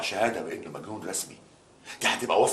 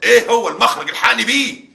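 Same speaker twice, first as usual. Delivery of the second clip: high, loud desperate pleading; panicked plea; 12 semitones higher, 9 dB louder than usual. Acted. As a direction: beg for help in panic. Panicked father begging lawyers.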